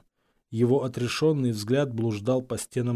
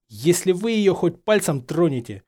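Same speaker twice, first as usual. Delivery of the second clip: raised, tense warning; hissed threat; 5 semitones higher, 4 dB louder than usual. A narrator's reading. Harry warning Snape off Hermione, hissing. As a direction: threaten him with feeling. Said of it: "прошипел Гарри".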